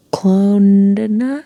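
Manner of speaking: slow, unsure try at Clondina?; a puzzled guess at Clondina?; unsure pronunciation. Real, not acted. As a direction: sound out Clondina? haltingly, questioning. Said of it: "I don't know how to say it".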